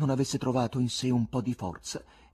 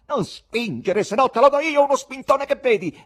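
loud, high quarrelling shout; angry threat; 13 dB louder than usual. A narrator's reading. Jostled spectator to another in the scramble, angry shout. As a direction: warn off someone who is shoving.

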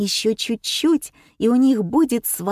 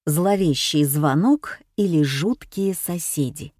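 usual speaking voice

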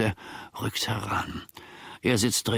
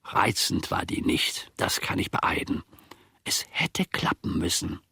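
breathy, hushed admission; confiding whisper; 3 semitones lower than usual. Acted. Servant admits in a whisper the bride was not pretty. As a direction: whisper it like a guilty confession.

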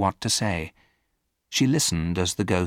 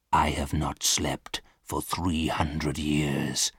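low, slow, weary ghost; lightly voiced ghost; 5 semitones lower, 4 dB quieter, slower than usual. A narrator's reading. Sleepless ghost to Virginia, slow weary voice. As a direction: lament it slowly and low. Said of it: "said the ghost".

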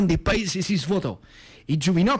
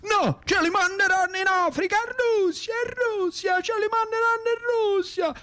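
furious father. Retold or imitated. Father raging about sons sent to war, high furious shout. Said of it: "sempre più incazzato".

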